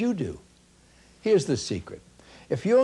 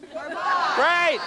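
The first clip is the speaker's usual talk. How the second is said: excited shout; 11 semitones higher than usual. Acted. Exclaims in speech climax.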